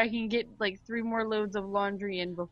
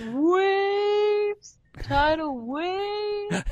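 slow wailing wave; 11 semitones higher, 6 dB louder, much slower than usual. Pretend. Mock wave sound effect.

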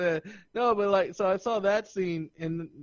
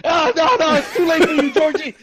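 higher, louder, laughing through it; laughing mock taunt; 12 semitones higher, 10 dB louder than usual. Friend mocks the kid, laughing loud.

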